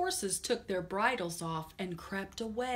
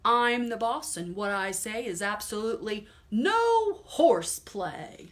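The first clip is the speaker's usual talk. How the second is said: loud, firm boss voice; bossy farmer; 8 dB louder than usual. Acted. Farmer ordering the horses, loud bossy voice. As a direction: lay down the law.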